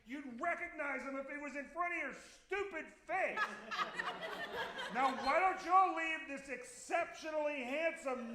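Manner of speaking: very high, strained yell held back in volume; mock screaming. Crazy person telling off fans across the street, mock-screaming high. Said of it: "I needed to scream at somebody".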